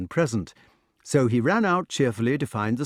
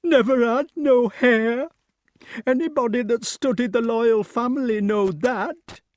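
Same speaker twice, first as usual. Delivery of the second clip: tearful; 12 semitones higher, 5 dB louder than usual. A narrator's reading. Nurse tells of the childless king, tearfully. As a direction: wail it out as a mourning nurse. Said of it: "said the nurse tearfully".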